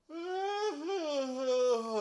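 high, drawn-out yawn; real yawn. Real, not acted. Contestant stalling before the laugh contest, real yawn. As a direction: let a long yawn escape. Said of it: "Oh, I got a yawn".

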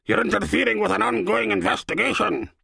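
mock the pompous boss; mocking impersonation. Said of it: "less than flattering impersonation".

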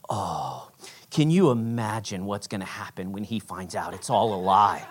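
very low, soft lament; acted pity, sunk right down; hushed mock dismay. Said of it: "I had a mini panic attack".

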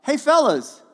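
high, loud call across the water; a big friendly hail from the shore; yelled greeting. Retold or imitated. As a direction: shout out to the fishermen, friendly. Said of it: "he yells out".

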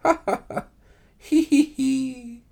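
high, unhinged cackle of a doomed man; a chilling high-pitched he-he-he; eerie high laugh. A narrator's reading. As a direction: cackle madly from behind the wall. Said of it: "a low laugh that erected the hairs".

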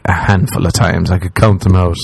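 ordinary delivery

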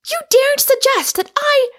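very high, very loud outburst; enraged cry. A narrator's reading. Rosamond protests Michael calling her mad, shrieking in rage. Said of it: "cried Rosamond in a rage".

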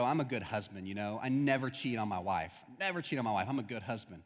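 mild smug husband brag; smug bragging. Retold or imitated. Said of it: "he has friends who come up and go".

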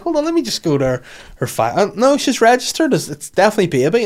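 high, quick imitation of the vet checking the file; mock vet. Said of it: "the vet's like".

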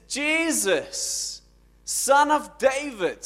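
high, loud, drawn-out call; acted shout of a beggar who won't be hushed. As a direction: cry out insistently, stretching the name. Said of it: "He just screams louder".

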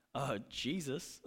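low, soft, drawn-out mimicked reply; imitated deadpan answer. Companion answering who his favorite Bible person is, slow quiet imitation. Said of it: "That's exactly how he said it".

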